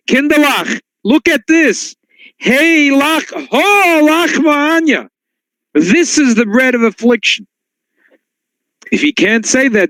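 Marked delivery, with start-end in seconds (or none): 0.1-7.5 put-on higher, drawn-out call to the children, eyes wide with wonder; excited Seder host. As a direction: proclaim it to the kids like you truly see Egypt.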